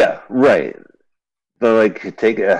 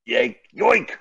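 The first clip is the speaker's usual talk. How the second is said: silly yoink voice. Pretend. Yank and yoink back-and-forth game.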